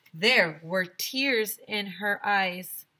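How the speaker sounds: usual speaking voice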